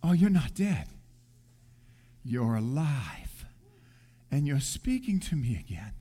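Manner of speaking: hushed and moved, barely believing; tender recognition. Retold or imitated. Believer realizing Jesus is alive, hushed and tender.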